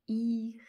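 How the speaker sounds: loud "ira" with each sound held: iii-rrr-a; syllabe décomposée. Shown as a demonstration. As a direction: sound out "ira", long i and long r before the a. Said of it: "J'ai besoin de trois lettres". (« ira » fort et étiré, i et r tenus)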